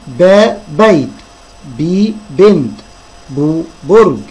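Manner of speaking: slow drill, long باء, بي, بو each before its word; teacher sounding out the first syllable of بيت, بنت, برج; syllable, then word. Shown as a demonstration. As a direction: stretch the vowels "baa", "bii", "buu", then say the word. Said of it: "نقولهم تاني".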